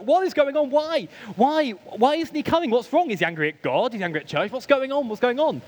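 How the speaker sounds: high, urgent string of questions; worked-up questioning. Retold or imitated. Venting to a friend about Dad missing church, worked up. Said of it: "my friend very calmly went".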